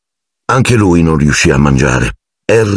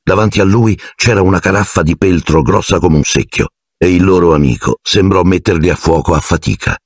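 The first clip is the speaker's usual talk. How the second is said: ordinary delivery, nothing marked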